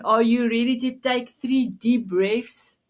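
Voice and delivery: normal talking voice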